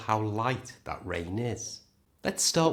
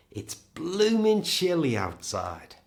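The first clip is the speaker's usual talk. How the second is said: cold and rubbing hands; 7 semitones higher, 4 dB louder than usual. Acted. Teacher acting out feeling the British cold. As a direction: say it like you're shivering in the cold. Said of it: "Try this sentence".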